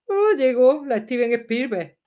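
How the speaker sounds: slow, mocking drawl at the critic; a sarcastic, slow oh, llegó. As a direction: tease the know-it-all viewer.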